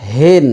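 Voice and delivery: হিন with an easy, unsqueezed h; relaxed h in হিন; plain open H. Shown as a demonstration. Contrast take with plain h.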